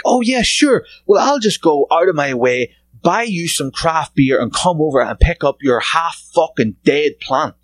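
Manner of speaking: high, mock-eager compliance; sarcastic agreement. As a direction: pretend you would happily oblige, dripping sarcasm. Sarcastically pretends to accept the trade.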